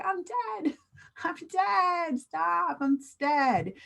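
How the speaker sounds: high-pitched giggly exclamations; acted hilarity. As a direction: squeal it as if helpless with laughter. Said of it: "I'm laughing really hard".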